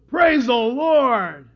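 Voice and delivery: loud, long-drawn rock voice; big drawn-out shout of praise. Acted. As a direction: bellow it slowly like a rock. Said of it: "And the rocks go".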